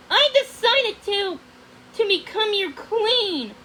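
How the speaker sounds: high, loud hurried declaration; nervous blurt. Acted. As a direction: blurt it out to distract him. Blurted lie to distract.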